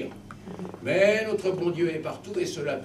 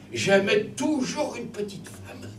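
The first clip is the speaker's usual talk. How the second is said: slow, higher sly admission; roguish confession; 7 semitones higher, slower than usual. Acted. Soul confessing his women to Saint Peter, roguish. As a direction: own up with a wink.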